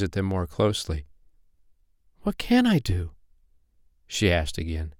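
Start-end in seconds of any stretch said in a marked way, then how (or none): 2.2-3.1 high, plaintive question, falling; helpless little fairy. A narrator's reading. Poppy Pink asking again for help, high and helpless. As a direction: ask high and at a loss.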